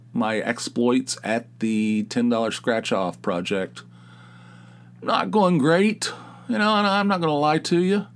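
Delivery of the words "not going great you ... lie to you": mock despair. Confessing gambling losses.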